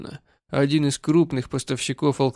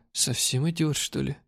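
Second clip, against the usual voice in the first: ordinary delivery, nothing marked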